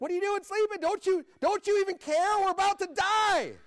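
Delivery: high, loud, frantic cry to a sleeping Jesus; panicked shout. Acted. Disciples wake Jesus in the storm, panicked high yell. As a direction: yell like the boat is going down.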